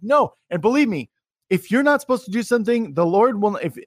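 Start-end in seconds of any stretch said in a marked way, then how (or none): 0.0-0.3 emphatic no. Retold or imitated. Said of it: "I'm like".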